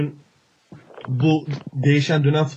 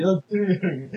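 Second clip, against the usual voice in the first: after laughing hard; 4 semitones higher, 4 dB quieter, slower than usual. Real, not acted. Laughing while denying.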